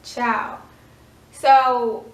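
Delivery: usual speaking voice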